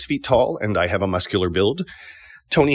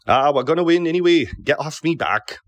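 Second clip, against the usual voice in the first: higher-pitched Geordie accent; Tony Elliott; 7 semitones higher than usual. Shown as a demonstration. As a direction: argue it in broad Geordie. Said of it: "he speaks in a Geordie accent".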